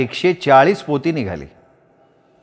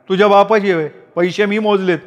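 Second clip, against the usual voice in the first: brother's retort; 7 semitones higher than usual. A narrator's reading. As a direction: sneer back, lightly acted. Brother claims the motorcycle. (भावाचा उपहास)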